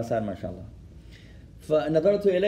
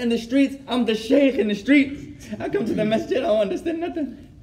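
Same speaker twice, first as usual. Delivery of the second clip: higher voice breaking into laughter; laughing retelling; 7 semitones higher, 4 dB louder than usual. Retold or imitated. Quoting his friend's confession to the class, laughing through it. Retold punchline of crying friend.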